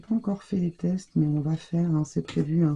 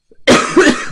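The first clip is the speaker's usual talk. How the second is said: loud unvoiced cough after choking; 12 semitones higher, 14 dB louder than usual. Real, not acted. Crafter chokes while talking to viewers, loud real cough. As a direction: cough as if something went down wrong. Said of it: "je me suis étranglée".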